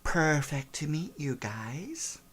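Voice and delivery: slow, soft, uninterested; the daughter greeting strangers with no interest; bored teen drawl. Acted. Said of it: "she goes".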